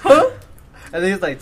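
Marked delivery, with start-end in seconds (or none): 0.0-0.3 a high, loud shocked huh; a mock-stunned yelp of huh. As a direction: blurt it out in disbelief. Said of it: "I was like".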